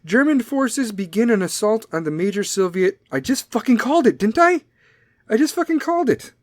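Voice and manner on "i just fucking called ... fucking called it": high-pitched, incredulous crowing; excited vindicated outburst; triumphant gloating. Real, not acted. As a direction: crow that you predicted it, pitch way up. Crows that own prediction came true.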